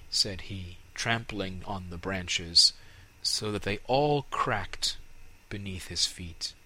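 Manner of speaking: ordinary delivery, nothing marked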